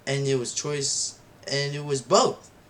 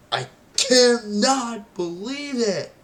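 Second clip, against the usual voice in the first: much higher and louder, slow and stretched; disbelieving exclaim; 10 semitones higher, 6 dB louder, slower than usual. Retold or imitated. Kid reliving Norman's death, exclaiming slowly in disbelief. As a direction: cry it out slowly in shock. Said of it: "I was like".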